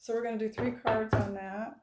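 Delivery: talking while chewing gum. Real, not acted. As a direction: chew gum as you talk. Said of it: "I'm chewing gum while I'm reading".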